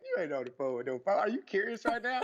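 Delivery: disbelieving retort with a laugh breaking in; half-laughing you can't be serious; laughing disbelief. Retold or imitated. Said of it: "I was like".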